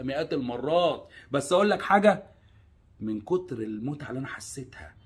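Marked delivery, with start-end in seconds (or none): 3.0-4.9 whisper. Real, not acted. Confessing the pleasure he felt.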